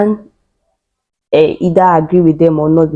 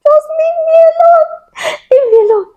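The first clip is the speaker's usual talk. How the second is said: very high screamed plea; high, piercing mock scream; retold scream; faster than usual. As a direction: scream to be left alone, re-enacting labor. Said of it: "I was screaming".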